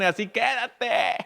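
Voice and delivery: high, slow, wry advice; mock world-weary warning; weary married person. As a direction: drawl it like a tired spouse. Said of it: "los casados dicen".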